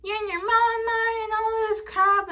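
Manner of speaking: high, mocking sing-song whine of the godmother; high mocking impression of an accusing relative. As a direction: mimic her nagging in a high whiny voice. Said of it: "She's like".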